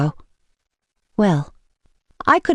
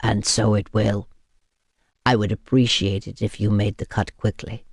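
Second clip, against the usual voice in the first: low, softer, composed man's voice; steady and courteous, low; calm vampire; 10 semitones lower, 4 dB quieter than usual. A narrator's reading. Vampire asks her to cut him quickly, calmly. Constantine's voice.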